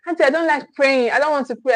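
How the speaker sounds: ordinary talk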